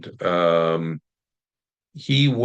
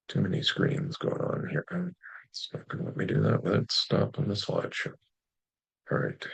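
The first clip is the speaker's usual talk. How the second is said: half-whispered muttering under breath; muttering to myself; 6 dB quieter than usual. Real, not acted. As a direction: mumble to yourself while fiddling with screens. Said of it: "I'm muttering to myself".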